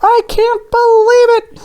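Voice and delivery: very high, drawn-out donut disbelief; shocked gasp. A narrator's reading. Donut learns he was made to be eaten, high-pitched shocked gasp. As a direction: squeal it slowly in stunned disbelief. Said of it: "Arnie gasped".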